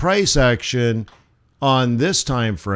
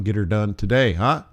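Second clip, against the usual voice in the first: ordinary delivery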